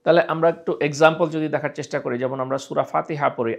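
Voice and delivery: usual speaking voice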